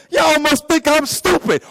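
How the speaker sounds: high-pitched, slow, affronted outburst; mock indignation. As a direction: play an offended God. Acting God's indignation at game players.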